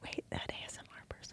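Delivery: barely audible whisper; faint, hushed ASMR question. Pretend. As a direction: whisper very softly into the mic. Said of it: "do a little E S M R".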